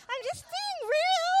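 high, loud laughing through the words; real laughter. Real, not acted. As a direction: laugh it out, embarrassed. Laughing after saying crap.

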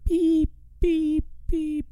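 high monotone truck beeps; backup beeper imitation. Host copying the beeping truck outside, backup beeper. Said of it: "It's like a backup beeper".

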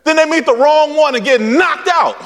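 high, ringing preacher's cry; preaching at full force. Acted.

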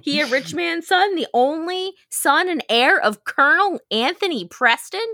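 incredulous snob, hammed up; mock indignation. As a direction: puff up with offended pride. Narrator acts Godfrey's outrage at insult.

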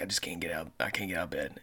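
low, quiet, mumbled sick-caller imitation; mock sick voice. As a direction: play the groggy sick employee, barely there. Driver calling in sick.

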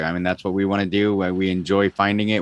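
usual speaking voice

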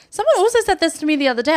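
laughing through the words, high and fast. Real, not acted. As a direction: giggle the line out, quick and high. Laughing retelling a compliment.

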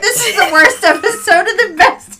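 high, loud laughing words; laughing hard. Real, not acted. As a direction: talk through a laughing fit. Laughing fit about the episode.

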